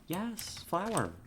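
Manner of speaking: high excited cooing. Real, not acted. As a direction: coo at the bee with delight. Cooing at the bees.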